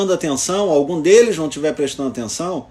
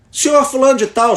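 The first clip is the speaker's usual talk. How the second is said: higher, firm call to someone nodding off; calling out by title; 5 semitones higher than usual. Retold or imitated. Lawyer addressing a tired judge mid-argument, calling out by title. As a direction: raise the pitch to get his attention. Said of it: "chamei pelo título".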